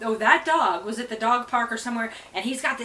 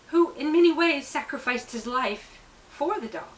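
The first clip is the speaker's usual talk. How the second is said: ordinary delivery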